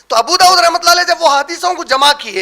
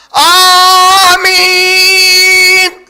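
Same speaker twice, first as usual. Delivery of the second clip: drawn-out, shouted آمین, very loud and stretched; overdone shouted آمین; screamed amen; 3 semitones higher, 7 dB louder, much slower than usual. Shown as a demonstration. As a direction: scream آمین at full volume, long. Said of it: "ایسا چیخ کے نہیں بولتے تھے".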